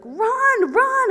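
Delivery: high, loud barked run; coach yelling. Retold or imitated. Coach yelling at her to run, high and loud. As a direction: shout run at a player. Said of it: "So he's like".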